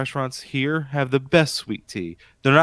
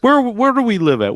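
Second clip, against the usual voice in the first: high, incredulous rhetorical question; exasperated question; 10 semitones higher, 4 dB louder than usual. Real, not acted. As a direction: ask it like it's obvious.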